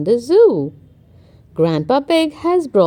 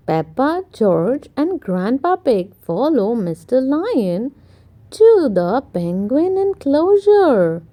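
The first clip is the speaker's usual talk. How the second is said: plain reading voice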